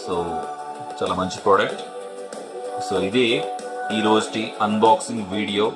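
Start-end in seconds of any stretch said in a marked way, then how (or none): none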